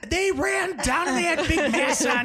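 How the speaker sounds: hammed-up high swooning voice. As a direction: gush over the firemen. Gushing about cute firemen.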